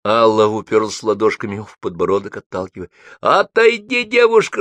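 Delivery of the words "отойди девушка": громкий злой крик на высокой ноте; рявкни, чтобы не мешали драться; злой рык. A narrator's reading. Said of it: "рычал водитель". (злобный окрик)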